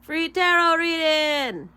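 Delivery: slow, loud announcer call. Pretend. Vlogger announcing her reading, big ad voice. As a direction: proclaim it like a TV psychic ad.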